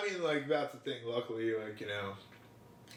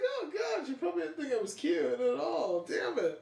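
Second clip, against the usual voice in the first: high whiny self-pity; 10 semitones higher than usual. Retold or imitated. Retold self-talk after seeing face.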